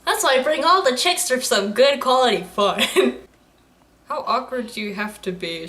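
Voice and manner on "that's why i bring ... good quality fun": normal talking voice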